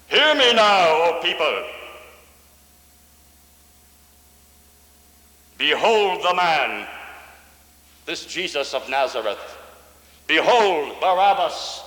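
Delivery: high, loud proclamation to the people; Pilate addressing crowd. Acted. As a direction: announce it like a Roman governor calling out to the mob. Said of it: "And Pilate said".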